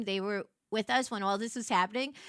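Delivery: ordinary talk, nothing marked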